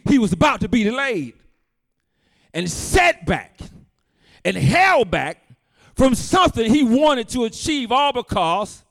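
loud, driving pulpit preaching; full-force preaching. Acted. Pastor preaching hard to the congregation, loud and driving. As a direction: preach it out to the back pews.

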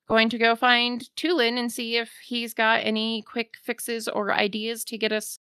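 ordinary talk

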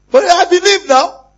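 high loud slow cry; preacher peaks on his own faith; climactic shout. Real, not acted. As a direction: stretch it out at full voice.

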